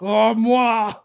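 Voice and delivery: mock French woman. Mimicking French woman moaning.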